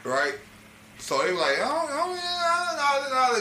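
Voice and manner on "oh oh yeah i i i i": much higher, drawn-out "oh"; mock stammering reaction. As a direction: stall on a high-pitched stammer. Acting out their stammering reaction.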